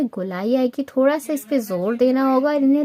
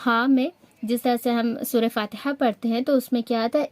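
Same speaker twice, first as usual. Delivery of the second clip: usual speaking voice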